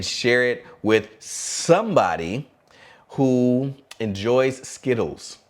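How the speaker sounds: very slow, stretched words; slow dramatic pause before the punchline; drawn-out tease. Acted. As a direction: stretch it out for suspense.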